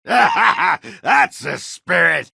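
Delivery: high, slow booming laugh into the words; laughing approval. Acted. Dwarf laughing as he cheers on the Warden. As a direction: laugh it out, then cheer him on.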